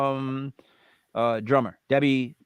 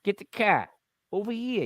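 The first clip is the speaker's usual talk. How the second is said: higher-pitched mock Boston accent; Wahlberg-style Boston accent; 6 semitones higher, 3 dB quieter than usual. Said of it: "that Boston accent".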